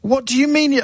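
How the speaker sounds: high, indignant protest; cross retort. Retold or imitated. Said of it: "I got quite cross".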